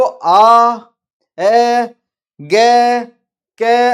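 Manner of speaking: आ, ए, गे, के each loud and held long; sounded-out syllables. Shown as a demonstration. Teacher showing end vowels to pupils, each syllable loud and drawn out. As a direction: call out आ, ए, गे, के one by one, stretching each vowel. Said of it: "last में किसकी sound आ रहा है".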